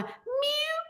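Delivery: very high, soft little mews; kitten meowing. Retold or imitated. Retelling how the kitten went, little meows. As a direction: imitate a tiny kitten crying out. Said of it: "it was going".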